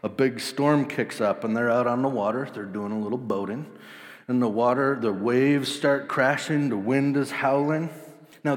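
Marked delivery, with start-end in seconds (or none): none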